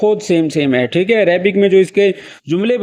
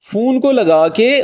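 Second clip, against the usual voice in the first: ordinary talk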